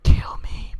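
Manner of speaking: breathy voiceless whisper of a dying pumpkin Pokemon; whispered plea. Retold or imitated. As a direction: voice Weezing begging for death in a whisper. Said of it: "He's just like".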